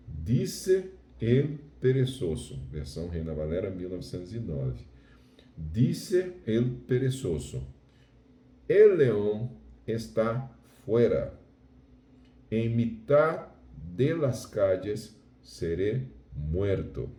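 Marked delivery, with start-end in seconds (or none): 0.1-2.6 slow, deliberate Spanish verse reading; measured Spanish recitation. Shown as a demonstration. Reads Spanish Bible verse aloud. 5.6-17.1 slow, deliberate Spanish verse reading; measured Spanish recitation. Shown as a demonstration. Reads Spanish Bible verse aloud.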